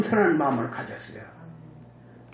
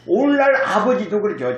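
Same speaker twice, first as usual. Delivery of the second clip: usual speaking voice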